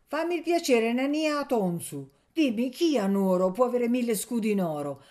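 high, loud, pressing appeal; insistent old woman. A narrator's reading. Old servant asking Anania to settle the argument, insistently.

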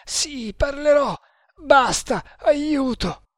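very high, desperate cries; agonised begging. Acted. The prisoner gives in under torture, crying out desperately. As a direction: cry out for mercy, high and strained.

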